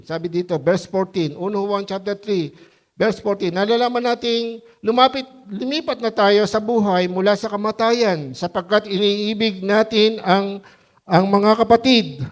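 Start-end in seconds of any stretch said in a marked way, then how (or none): 3.5-12.3 loud, slow scripture reading from the pulpit; preaching scripture. Acted. Pastor reading First John to the congregation, loud preaching. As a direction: proclaim the verse, loud and drawn out.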